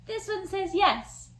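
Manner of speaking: ordinary talk, nothing marked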